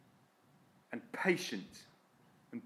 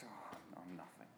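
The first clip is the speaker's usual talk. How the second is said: much lower and far softer, almost gone; deflated whisper; 8 semitones lower, 20 dB quieter than usual. Retold or imitated. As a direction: let it drain away, hardly there. Voicing a self-doubting person.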